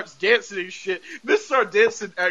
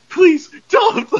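pleading squeal; 10 semitones higher, 6 dB louder than usual. Retold or imitated. Vince pleading with Missus Hart.

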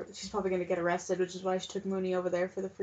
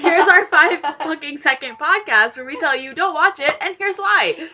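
louder and higher, laughing through the words; laughing joke; 6 semitones higher, 12 dB louder than usual. Real, not acted. Joking to listeners about a short podcast, laughing.

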